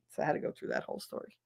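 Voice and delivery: ordinary talk, nothing marked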